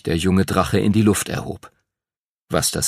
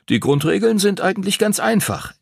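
much higher, eager explaining; excited; 9 semitones higher than usual. A narrator's reading. Mark to Henry about dragonball, higher and excited. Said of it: "erklärte Mark aufgeregt".

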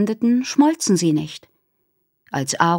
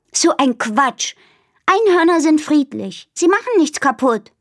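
indignant Minnie; 8 semitones higher, 4 dB louder than usual. Acted. Minnie scoffing at Aru about unicorns, indignant. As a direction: brush off the silly idea.

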